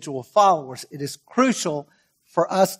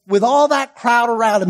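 ordinary talk

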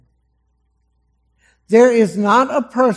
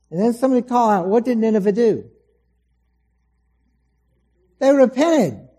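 ordinary talk

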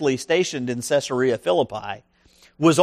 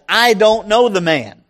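emphatic cursing denial; 5 semitones higher, 6 dB louder than usual. Retold or imitated. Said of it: "started cussing to emphasize".

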